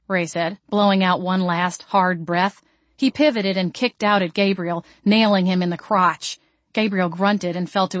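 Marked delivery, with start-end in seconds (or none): none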